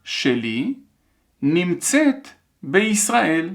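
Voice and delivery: careful dictation in separated chunks; measured phrase-by-phrase dictation. Shown as a demonstration. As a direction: read it out for learners to write, pausing between chunks. Teacher dictating a sentence.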